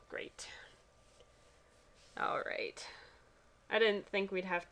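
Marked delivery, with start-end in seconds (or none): 0.1-2.9 1 sarcastic whisper. Real, not acted. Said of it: "some kind of impression".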